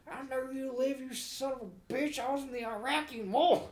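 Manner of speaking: put-on high, cranky old man voice; mock old veteran. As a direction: rant like an angry old vet. Callers posing as old people.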